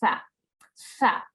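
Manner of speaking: "Tha, tha" with th as in thin, a pause between; two separate airy "tha" syllables; English TH sound. Shown as a demonstration. Teacher repeating the letter for the class, th-sound "tha". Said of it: "like an English T H sound".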